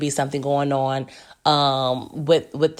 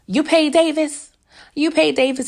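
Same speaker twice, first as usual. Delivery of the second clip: shocked question; 9 semitones higher than usual. Retold or imitated. Character's shocked question, retold.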